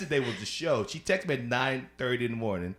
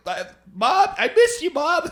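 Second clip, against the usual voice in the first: mock whine; 10 dB louder, slower than usual. Mock crying for dead mom.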